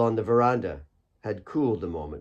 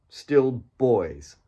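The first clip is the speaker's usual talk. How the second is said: ordinary delivery, nothing marked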